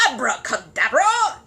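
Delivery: loud, slow furious shout; raging shout. Acted. Curry to Paddington, raging shout. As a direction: bellow it in a rage. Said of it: "shouted Mister Curry, beside himself with rage".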